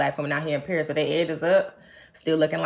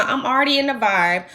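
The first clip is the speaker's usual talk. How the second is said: normal talking voice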